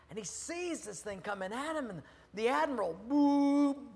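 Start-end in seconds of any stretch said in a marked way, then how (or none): 3.1-3.9 loud, high hooting horn; loud boop like a ship's horn; imitated horn blast. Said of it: "blows his horn".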